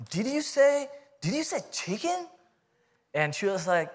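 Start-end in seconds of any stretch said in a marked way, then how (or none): 0.1-2.3 high-pitched, incredulous double-take question; retold astonishment, voice leaping up and softening; stunned disbelief. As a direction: ask it as if you can't believe your ears. Retelling his stunned reply about chicken.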